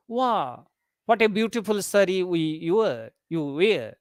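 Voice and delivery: ordinary delivery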